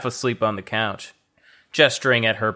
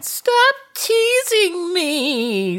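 much higher whine, stress on wear; whiny high Thea voice; much slower than usual. Acted. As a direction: whine it like a sulky child. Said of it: "Thea squirmed".

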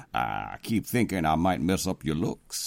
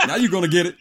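laughing threat; 10 semitones higher, 6 dB louder, much faster than usual. Acted. President threatening in fight.

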